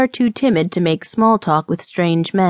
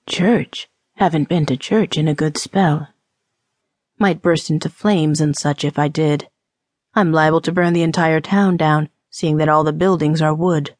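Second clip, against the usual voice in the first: lower, drier man's voice, joking; teasing rogue; 3 semitones lower than usual. Acted. Drinker joking with churchgoing woman, low and teasing. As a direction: play the unrepentant sinner, voice dropped.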